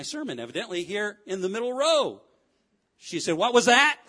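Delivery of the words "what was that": startled question. Acted. Acting out Siri talking back.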